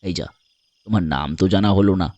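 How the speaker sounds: deep, deliberate man's voice; pensive stranger. Acted. (নিচু গলায় ভেবে ভেবে বলা)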